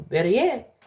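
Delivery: ordinary talk, nothing marked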